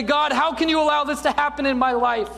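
a desperate cry toward heaven; crying out to God. Retold or imitated. Preacher voicing a believer crying out to God, loud plea. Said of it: "you cry out to God".

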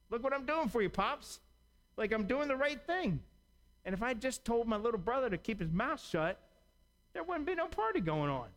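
a resentful son listing what he does for Pops; whiny older brother. Acted.

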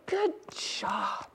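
softly whispered Good job. Shown as a demonstration. Teacher has children whisper praise to themselves. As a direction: whisper it to yourself. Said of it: "Just say".

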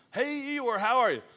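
high, bright mock greeting; cheery greeter. Friend greeting gloomy Eeyore, high and cheery. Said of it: "cheery greeting to Eeyore".

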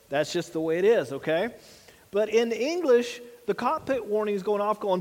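ordinary talk

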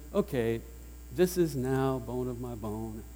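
a mock-bored, deliberate "Okay, this is now bone of my bone"; flat and matter-of-fact, not thrilled; flat calculated delivery. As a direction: say it dry and unimpressed, like ticking a box. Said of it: "they really sound rather calculated".

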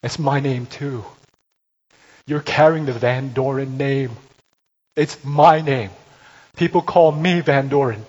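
father pressing his claim harder; father's appeal building to loud; rising father's appeal. Retold or imitated.

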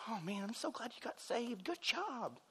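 tiny, high, very soft voice; mock congratulation. Churchgoer congratulating new convert, high hushed mock praise. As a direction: imitate a feeble little pat on the back.

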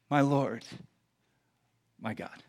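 soft, low, reverent; hushed awe. Acted. Thomas before the risen Jesus, hushed awe. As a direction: let it drop almost to nothing.

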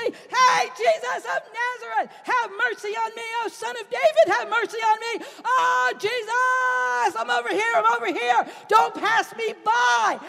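high, loud desperate shouting; beggar shouting for help. Acted. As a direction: cry out over the crowd so Jesus hears. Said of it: "Blind Bartimaeus says".

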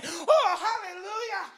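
high, reared-back hallelujah; passionate praise cry. Shown as a demonstration. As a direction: demonstrate a sudden cry of praise. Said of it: "rear back every once in a while and say".

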